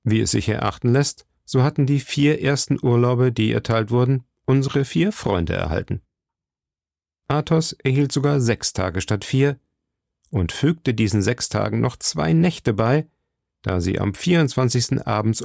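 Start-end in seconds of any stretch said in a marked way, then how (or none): none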